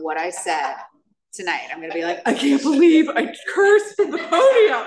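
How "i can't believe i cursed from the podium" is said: high, loud, laughing mock horror; mock self-scolding. Imagining herself on the drive home, high laughing mock horror. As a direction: wail at herself in playful dismay.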